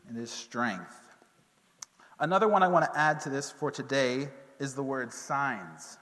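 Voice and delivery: ordinary delivery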